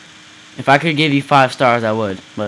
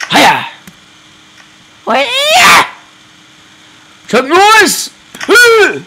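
much higher loud martial-arts shout; mock karate yell; 6 dB louder, much slower than usual. Gamer playing Chuck Norris, mock karate shout. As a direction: yell like a kung fu fighter.

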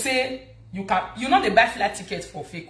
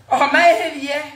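high, loud pained exclamation; loud groan of frustration; exasperated cry; 6 semitones higher, 6 dB louder, slower than usual. Real, not acted. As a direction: cry out as if the head aches from it all. Ranting, clutches head in exasperation.

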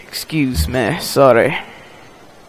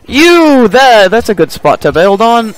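loud calling out; 6 semitones higher, 7 dB louder than usual. Pretend. Calling out orders.

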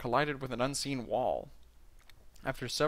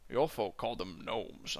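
Sean Connery impression; 5 semitones lower, 5 dB quieter than usual. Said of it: "do a Sean Connery impression".

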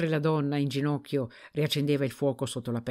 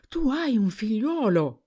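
higher and louder, disbelief bursting out; the news finally sinks in, exclaim it; astonished outburst; 7 semitones higher, 4 dB louder than usual. A narrator's reading. Husband realising his wife has a son, astonished outburst. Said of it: "non faceva che ripetere".

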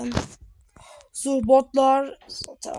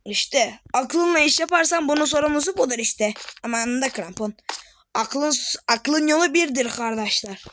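ordinary talk, nothing marked

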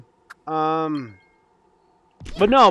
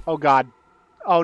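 normal talking voice

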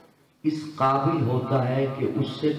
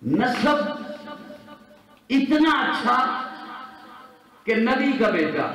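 raised, emphatic sermon voice; preaching; 9 semitones higher than usual. Real, not acted. Preacher contrasting lineage for the crowd, raised preaching. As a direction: lift each phrase and let it ring.

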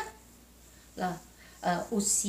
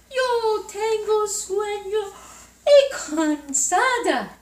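high, slow, whiny sleepy voice; sleepy Goldilocks; 11 semitones higher, 6 dB louder, slower than usual. Acted. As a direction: drag it out like a tired little girl. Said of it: "dice Ricitos de Oro". (voz de niña cansada y soñolienta)

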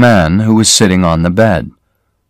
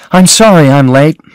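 a little higher and louder, calling across the office; called out; 7 semitones higher, 5 dB louder than usual. A narrator's reading. Marion calling her apology to a coworker, raised. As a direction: call the apology as she hurries in. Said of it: "Marion called".

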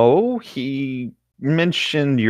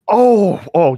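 gasped oh, higher and louder; surprised gasp; 9 semitones higher, 8 dB louder than usual. Real, not acted. Realizing which tin cookies the friend means, a gasped oh. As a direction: catch your breath as it clicks.

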